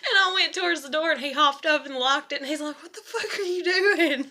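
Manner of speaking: words breaking into giggles; laughing through it. Real, not acted. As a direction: quote the husband while cracking up. Laughing while retelling husband's reaction.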